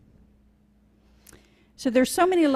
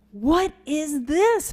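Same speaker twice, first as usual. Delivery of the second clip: high, slow upset outcry; upset cry; 7 semitones higher, 3 dB louder, slower than usual. Acted. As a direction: Vulture cries out in dismay, stretched slowly. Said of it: "Vulture cried".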